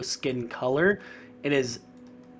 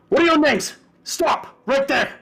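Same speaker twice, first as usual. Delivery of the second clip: loud, high barked orders to halt; commanding shout; 11 semitones higher, 6 dB louder than usual. Acted. Guard captain shouting at the intruding party to halt.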